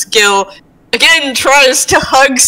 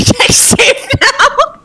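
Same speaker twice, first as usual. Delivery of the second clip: laughing through the request; real amusement; 10 semitones higher, slower than usual. Real, not acted. Player asking for a dex save, laughing. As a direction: break into a laugh while talking.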